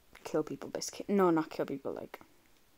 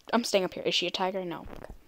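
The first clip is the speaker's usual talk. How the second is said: ordinary talk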